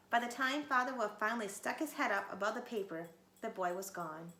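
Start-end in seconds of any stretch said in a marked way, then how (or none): none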